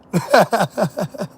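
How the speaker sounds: long staccato cackle; laughing. Real, not acted. Mocking listeners over the job interview, laughing. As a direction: burst out in a rapid cackle. Cackling after acted "Prove it.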